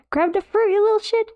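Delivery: high-pitched, exasperated command; exasperated urging. Real, not acted. Streamer urges creature to grab the fruit, exasperated and high.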